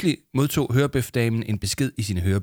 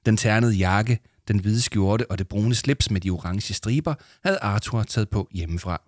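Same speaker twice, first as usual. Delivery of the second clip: ordinary delivery, nothing marked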